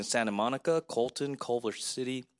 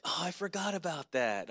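higher-pitched mild surprise; a memory suddenly coming back; lightly acted "oh, I'd forgotten"; sudden recollection; 6 semitones higher than usual. Acting out a triggered memory.

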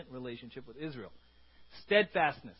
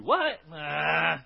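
incredulous, then dismissive; 7 dB louder than usual. Acted. Hurt person answering someone who says God loves them, incredulous. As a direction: scoff at the idea. Wounded person rejecting God's love.

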